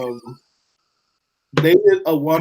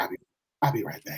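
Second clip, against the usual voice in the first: sneaky whisper; 7 semitones lower, 11 dB quieter, much faster than usual. Retold or imitated. Imagined boyfriend sneaking away.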